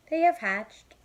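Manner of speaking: high, loud, thrilled; excited Piggy. Acted. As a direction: announce it with glee.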